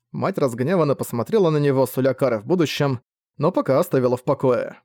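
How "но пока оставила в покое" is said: ordinary delivery, nothing marked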